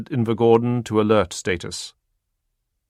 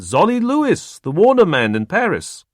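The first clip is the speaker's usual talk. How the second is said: put-on beam out the greeting in an Eastern European accent; 10 semitones higher, 6 dB louder than usual. Warner man greeting Stahl eagerly, Eastern European accent. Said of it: "His accent was from somewhere well east".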